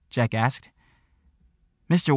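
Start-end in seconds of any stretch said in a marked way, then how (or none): none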